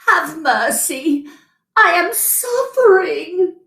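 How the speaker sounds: slow, high pleading voice; mild weeping. A narrator's reading. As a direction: plead through tears. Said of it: "he wept so bitterly".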